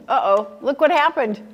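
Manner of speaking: ordinary delivery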